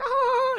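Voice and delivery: high-pitched mimicked bird cackle; kookaburra laugh. Shown as a demonstration. Kookaburra heard in the background, imitated laugh. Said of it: "you know, their laugh noise".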